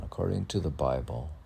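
ordinary delivery

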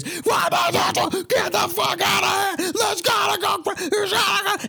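very high, shouted; frantic shouting heard when the door opens; chaotic yelling. Retold or imitated. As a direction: yell like chaos behind a door. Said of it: "all you hear is".

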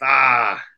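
long, loud "ah" of dismay; loud realizing groan. Retold or imitated. Guest realizing the clerk is right, loud groan.

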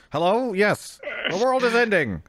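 put-on high crackly old phone voice; over an old rotary phone. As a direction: squeak it like a crank phone call. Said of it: "like, an old rotary phone".

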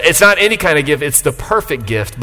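ordinary talk

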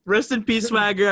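usual speaking voice

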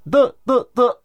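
「ど」をたとだの中間の音で; 有気でも濁音でもない「ど」を繰り返す; たとだの間. Shown as a demonstration. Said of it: "たとだの間です". (「ど」をたとだの間の音で)

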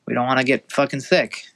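usual speaking voice

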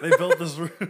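laughing. Real, not acted. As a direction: crack up while repeating it. Both laughing, repeating the joke.

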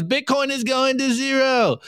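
mocking doubter voice. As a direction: sneer it like a smug doubter calling a crash. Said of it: "And he goes".

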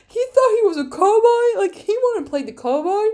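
high, loud mocking question; incredulous mockery. To viewers about Lance, a high incredulous scoff. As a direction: scoff at him in disbelief.

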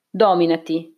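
usual speaking voice

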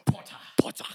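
sneering whisper; whispered mock sneer; Draco Malfoy whisper. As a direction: hiss the name like a schoolboy rival. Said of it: "how does Draco Malfoy say it".

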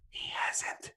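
hushed spoiler whisper; soft, breath-only aside; mock-secret whisper.

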